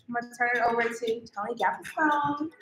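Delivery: usual speaking voice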